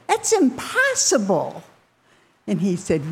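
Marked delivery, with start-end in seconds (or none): 0.1-1.6 high-pitched disbelieving protest; incredulous disciples. Retold or imitated. Disciples answering Jesus about the camel and needle, incredulous. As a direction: question him in squeaky-high disbelief. Disciples react to a hard saying.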